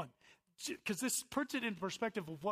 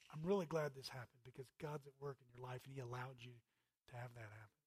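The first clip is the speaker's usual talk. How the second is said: lower, much softer consoling voice; gentle counsel; 7 semitones lower, 9 dB quieter than usual. Retold or imitated. Pastor gently consoling a believer whose sin was exposed. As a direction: soothe someone caught in sin.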